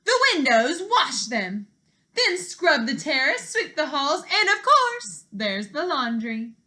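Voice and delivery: loud, high, commanding list of chores; bossy stepmother. Acted. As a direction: order her about like a cruel stepmother.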